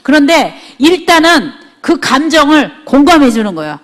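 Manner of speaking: normal talking voice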